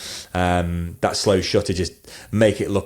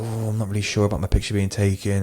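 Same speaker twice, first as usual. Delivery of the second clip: lightly imitated hesitant groom, a bit softer; reluctant groom; 4 dB quieter than usual. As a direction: mildly mimic a camera-shy groom hedging. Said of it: "not all grooms like this".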